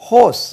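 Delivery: ஹோஸ் said loud and high, long "o", no "r"; teacher's emphatic ஹோஸ், falling at the end; model pronunciation. Shown as a demonstration. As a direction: punch out ஹோஸ் as "hohs". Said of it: "ஏற்கனவே இது சொல்லியிருக்கேன் உச்சரிப்போம்".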